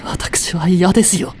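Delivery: ordinary talk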